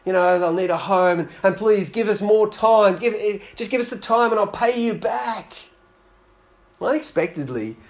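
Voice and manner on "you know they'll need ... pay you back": begging. Acted. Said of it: "the man starts begging".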